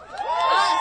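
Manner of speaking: huge high shout of a single word; shouted cry. Real, not acted. Rally speaker to crowd, full shout. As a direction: yell it out to the crowd.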